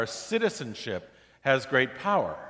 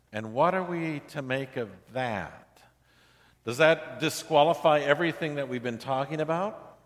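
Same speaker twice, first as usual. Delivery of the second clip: ordinary talk, nothing marked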